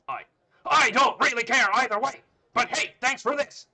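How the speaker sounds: very loud, high dismissive shout; big shouted bully voice; booming bully. Acted. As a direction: bellow it carelessly. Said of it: "A loud booming voice".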